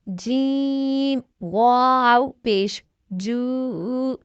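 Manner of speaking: slow, stretched drill voice, each syllable held long; letter by letter, drawn out and distinct; slow spelling recitation. Shown as a demonstration. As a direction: spell it out slowly for a child learning to read.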